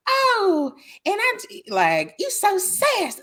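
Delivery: very high, cartoonish put-on voice; mocking caricature voice.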